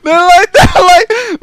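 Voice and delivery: high, loud laughter breaking through the words; slow, wheezing through giggles; laughing. Real, not acted. Friend asks why he's laughing, he keeps cracking up. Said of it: "Why are you laughing about it".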